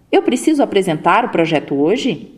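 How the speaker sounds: rising question tune ending up on "hoje"; question intonation. Shown as a demonstration. Speech coach shows listeners a question, rising intonation on "hoje". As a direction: ask it, lifting "hoje" at the end as a question. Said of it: "Veja essa pergunta".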